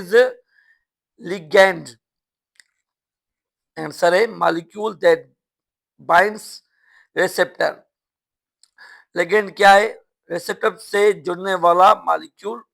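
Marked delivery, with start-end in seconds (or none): none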